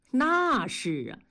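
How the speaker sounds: slow, mildly self-satisfied drawn-out agreement; smug. A narrator's reading. Coworker boasting of his years as women's representative, slow and smug. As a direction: savour the boast a little. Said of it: "大庄得意地说".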